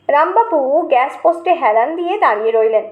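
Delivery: usual speaking voice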